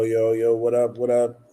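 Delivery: ordinary delivery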